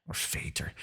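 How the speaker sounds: breathy whisper. Acted. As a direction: let the word fall away in a whisper. Villain muses on defeat.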